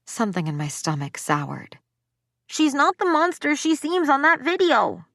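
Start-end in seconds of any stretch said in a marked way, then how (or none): 2.5-5.1 put-on high, loud kid protesting from under a cone; muffled girl's voice. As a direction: insist hotly, as if muffled. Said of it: "a muffled voice countered".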